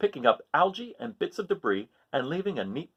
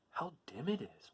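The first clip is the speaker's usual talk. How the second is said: much softer murmur; murmuring anemone; 2 semitones higher, 14 dB quieter than usual. Acted. Sea anemone in the gloomy seaweed, soft murmur. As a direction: murmur it into the dark. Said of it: "murmured the sea anemone".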